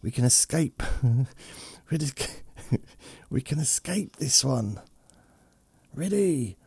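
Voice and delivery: lightly high, conspiratorial flea voice; whisper-plot like a tiny flea pirate; fleas talking. Pretend. Fleas on his shoulder plotting their jump, lightly high. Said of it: "Like, talking".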